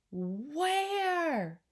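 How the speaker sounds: high, long, swooping question; exasperated call. Acted. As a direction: stretch it out in bewilderment. Father searching, growing frantic.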